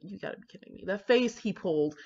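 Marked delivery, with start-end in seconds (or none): 0.0-0.8 whispered under the breath; a hushed aside of disbelief. Real, not acted. Recalling the heartbreaking wedding.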